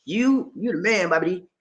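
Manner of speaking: hint of a voiced viewer; reading a viewer comment. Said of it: "He said".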